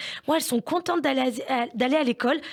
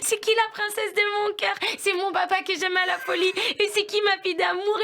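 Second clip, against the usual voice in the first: mock baby talk, voice pitched up; gagatisme père-fille; 8 semitones higher than usual. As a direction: coo it like a doting dad. Mère imite père et fille collés. (voix gaga de papa qui bêtifie avec sa fille)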